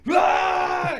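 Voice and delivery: high, loud scream of pain. Retold or imitated. Finger caught in the door, a high loud yell. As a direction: let out a sharp high yell. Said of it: "And I'm like".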